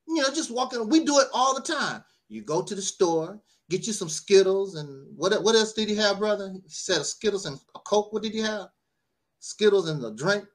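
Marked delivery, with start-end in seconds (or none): none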